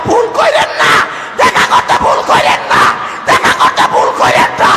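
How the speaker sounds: high chant building to a screamed plea; impassioned sermon climax at top volume; full-force preaching. Acted. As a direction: climb from loud chant into all-out hoarse shouting.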